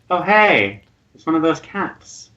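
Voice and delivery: lightly acted "oh hey" of recognition; pleased recognition. GM voices Ornstein recognising the creature.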